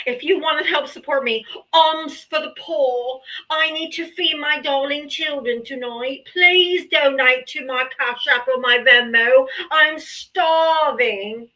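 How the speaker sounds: hammed-up pleading for donations; theatrical beggar. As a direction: ham up a poor beggar asking for alms. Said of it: "Was that a good enough beg?".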